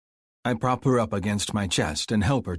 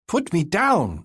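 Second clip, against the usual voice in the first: much higher, louder bellow; 10 semitones higher, 5 dB louder, slower than usual. A narrator's reading. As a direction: bellow the order at him. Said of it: "she bellows again".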